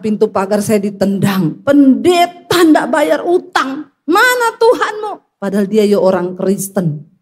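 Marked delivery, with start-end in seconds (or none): 1.6-5.4 high, slow mock taunting; drawn-out, pitched-up jeer; debt collector's taunt. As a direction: imitate a collector publicly shaming a pastor. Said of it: "Debt kolektornya datang".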